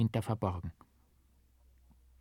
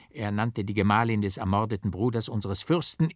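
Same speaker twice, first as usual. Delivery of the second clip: usual speaking voice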